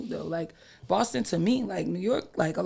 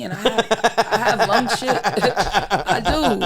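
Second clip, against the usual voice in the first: laughing through the words; 2 semitones higher, 4 dB louder, much slower than usual. Real, not acted. Friend laughing about his lungs. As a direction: chuckle while admitting it.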